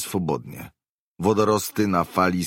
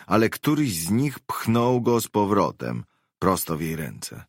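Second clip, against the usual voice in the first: ordinary delivery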